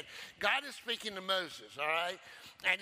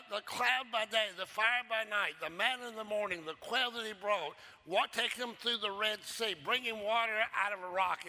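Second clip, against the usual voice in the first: ordinary delivery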